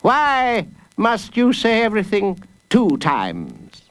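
high, slow interrogation; exasperated judge. Acted. Judge leaning toward the boy, exasperated. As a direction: space it out high, demanding an answer.

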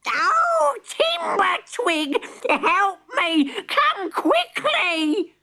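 very high, slow cry for help; panicked granny. Acted. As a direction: wail for help.

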